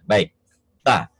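ordinary delivery

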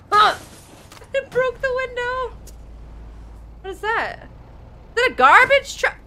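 shocked, pitch leaping high; startled high exclamations. Real, not acted. Player shocked at the game's crash, shouting high. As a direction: cry out in sudden surprise.

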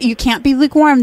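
ordinary talk

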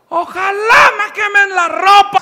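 high, slow, laughing plea; a feeble voice begging up high; a voice from the street begging them to burn its clothes; mock frail voice. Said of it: "una voz tenue desde la calle".

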